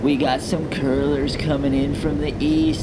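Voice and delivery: loud laid-back surfer bro; surfer dude. Acted. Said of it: "the surfer voices".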